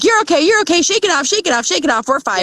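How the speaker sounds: high, quick mock-cheerful parent; soothing parent brushing it off. Parent to a child who skinned a knee, high dismissive soothing. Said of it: "We're like".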